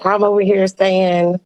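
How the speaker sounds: ordinary talk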